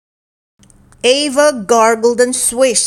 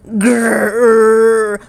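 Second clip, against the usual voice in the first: drawn-out growl; growl from the bush; 3 dB louder than usual. A narrator's reading. Ava growls from inside the bush, sounding like a monster. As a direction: rumble like a hidden beast. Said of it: "the bush rattled".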